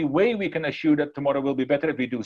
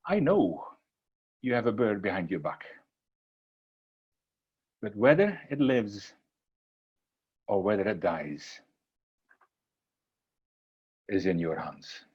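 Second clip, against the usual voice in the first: put-on wise old man; 7 semitones lower, 4 dB quieter than usual. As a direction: let it sink lower and softer, phrase by phrase. Old man's solemn answer ending story.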